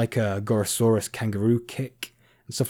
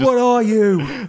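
high, slow mock chef bellow; hammed-up angry chef shout; Gordon Ramsay impression; 12 semitones higher, 9 dB louder, slower than usual. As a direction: yell it at a useless cook. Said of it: "a Gordon Ramsay voice".

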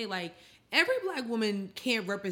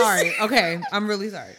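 loud, giggly sorry; can't stop laughing mid-apology; 11 dB louder, faster than usual. Real, not acted. Still laughing while apologizing.

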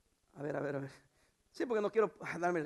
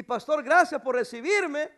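high, loud, drawn-out gratitude; acted exuberant thanks; joyful young man; 6 semitones higher, 10 dB louder, slower than usual. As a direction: thank the pastor with hammed-up joy. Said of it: "el muchacho anda bien gozoso".

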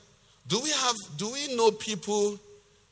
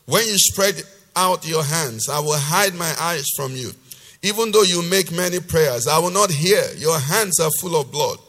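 loud, emphatic preacher's reading; forceful scripture reading; 3 semitones lower, 6 dB louder than usual. Acted. Preacher reading God's rebuke to the congregation, loud and emphatic. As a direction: declaim God's rebuke with hard-hit key words.

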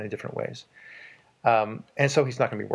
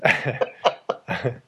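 laughing. Real, not acted.

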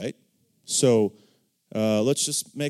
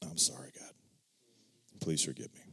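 soft, low murmured apology; hushed repentance; 9 semitones lower, 13 dB quieter than usual. Retold or imitated. Reader convicted by the book asks God's forgiveness, hushed and contrite. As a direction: whisper a contrite little prayer. Retold private prayer of repentance.

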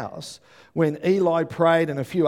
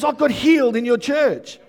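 higher, louder retold good news; excited announcement; 9 semitones higher, 6 dB louder than usual. Preacher quoting a friend telling him of healing, excited. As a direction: proclaim it like thrilling news.

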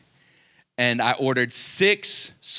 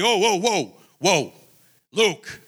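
a firm, heavy warning from a big guy; lightly voiced tough guy, don't argue with me; strong; 3 semitones higher, slower than usual. Deli man insisting the pastor take free sandwiches, firm mock warning. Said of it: "he said it like that, like, strong".

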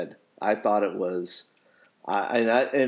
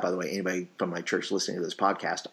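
quick, hushed aside; quiet aside; 5 semitones lower, 7 dB quieter, much faster than usual. Real, not acted. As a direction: toss it off softly and fast. Quick aside to church listeners.

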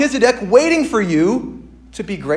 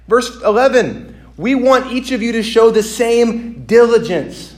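firm preaching; 6 semitones higher, 5 dB louder than usual. Acted. To the congregation about God's fairness, firm preaching. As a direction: hammer each line home.

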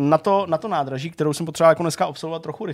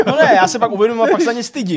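laughing protest; 9 semitones higher, 9 dB louder, faster than usual. Real, not acted. Laughing protest at a friend's tease.

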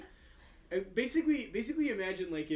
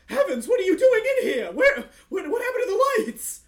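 high, loud panicked cry; a startled old clerk yelping; startled shriek; 17 dB louder than usual. Acted. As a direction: shriek in alarm at the sudden dark. Startled NPC voiced.

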